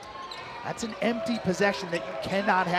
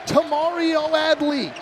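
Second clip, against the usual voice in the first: excited call; 7 semitones higher, slower than usual. Real, not acted. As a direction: sell the big three to the crowd. Call of a long made shot.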